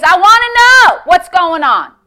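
impassioned cry for answers; full force. Retold or imitated. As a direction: insist boldly on knowing. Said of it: "He went full force".

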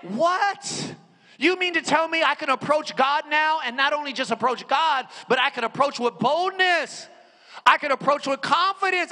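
high, loud preacher voice; full-force preaching. Acted. Preacher rousing the congregation about approaching God, full-force preaching. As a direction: preach it to the room.

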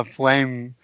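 normal talking voice

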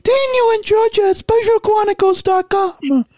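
funny voice. Pretend. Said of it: "my funny voice".

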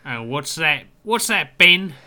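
rising guttural orc bark. Acted. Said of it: "all the orc voice I can muster".

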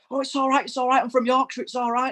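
lightly voiced stage invader, high and pleading; mock-innocent fan excusing himself; imitating the fan. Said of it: "turns around and goes".

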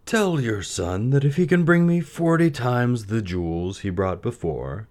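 lightly voiced high, slow sultan; a touch of a scheming king; a hint of a sultan. A narrator's reading. Sultan answering the mother, lightly voiced and scheming.

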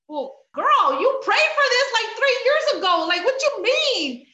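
high, loud sassy voice; sassy retort. Retold or imitated. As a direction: talk back like a sassy friend. God answering her complaint.

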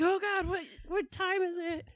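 high, loud groggy panic; jolted out of sleep; startled awake. Retold or imitated. Woken by blaring TV.

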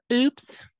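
squeaky high-pitched oops; funny high oops. Acted. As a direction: pitch way up and stretch it. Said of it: "I wanna hear your he".

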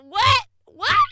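very loud, high mock "what?"; exaggerated baffled shouting; incredulous shout. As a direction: yell it like you can't understand a word. Mimics not understanding a thick accent.

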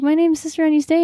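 high, quick, cheerful; bright introduction. Retold or imitated. Sister telling the driver her name, high and quick. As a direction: introduce yourself with a grin. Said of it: "I'm like".

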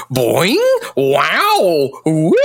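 springy, swooping pitch slides; cartoon sound effects. Co-host mimicking the fight's sound effects, swooping cartoon noises. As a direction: imitate silly cartoon boings and whoops. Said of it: "all these cartoon sound effects".

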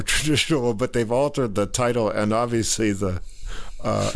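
ordinary delivery

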